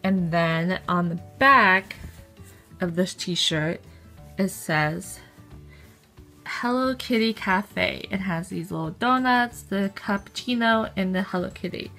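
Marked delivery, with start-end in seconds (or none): none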